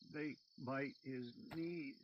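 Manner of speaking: tearful. A narrator's reading. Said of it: "Tears rose in his eyes".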